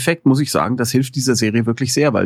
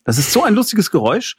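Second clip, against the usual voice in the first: usual speaking voice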